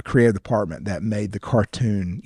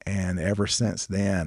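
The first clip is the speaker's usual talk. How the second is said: ordinary talk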